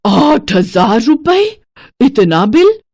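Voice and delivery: slow, disbelieving over the amount; stunned miser reading her bill; shocked. Acted. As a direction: stare at the bill aghast. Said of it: "घबरा जाती है".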